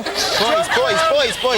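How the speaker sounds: high sing-song call across the room. Real, not acted. Calling the boys to order.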